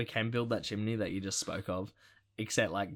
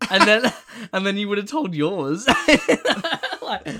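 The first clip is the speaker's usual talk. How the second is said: laughing; 10 semitones higher, 12 dB louder than usual. Real, not acted. Joking, laughing at own story.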